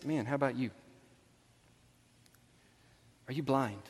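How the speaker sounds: low, soft, with long pauses; hushed solemn. Real, not acted. Preacher asking congregation if they are blind, hushed solemnity. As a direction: let each question sink in quietly.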